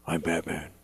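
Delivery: Batman voice. Pretend. Dad quoting himself as Batman.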